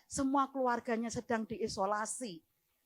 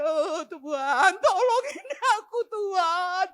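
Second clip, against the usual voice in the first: loud, drawn-out high wail to God; retold terrified prayer; 7 semitones higher, 9 dB louder, slower than usual. Pastor hugged by a mourner begging God's help, loud wail. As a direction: cry for help, hammed-up and slow. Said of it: "Oh, gemetar saudaraku".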